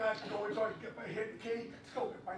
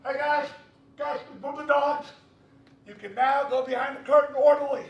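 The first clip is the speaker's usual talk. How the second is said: loud announcement; 3 semitones higher, 10 dB louder than usual. Retold or imitated. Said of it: "I hear this".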